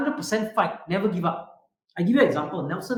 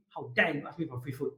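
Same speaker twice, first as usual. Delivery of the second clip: ordinary delivery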